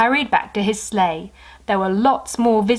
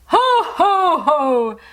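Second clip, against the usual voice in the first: much higher, louder jolly shout; 8 semitones higher, 5 dB louder, much slower than usual. Acted. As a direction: boom the greeting. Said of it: "shouted Santa loudly".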